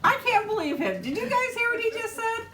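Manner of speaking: can't believe what he just said; shocked and amused. Real, not acted. Reacting to her husband's joke in front of viewers, incredulous and laughing.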